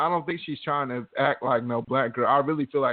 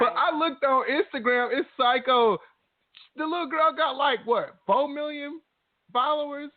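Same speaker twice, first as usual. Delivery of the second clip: amazed; 11 semitones higher than usual. Real, not acted. Excited gossip about Instagram numbers.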